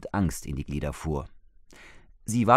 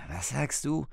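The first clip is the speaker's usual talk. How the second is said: disbelieving; 6 semitones higher, 6 dB quieter than usual. A narrator's reading. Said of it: "kam es ungläubig zurück".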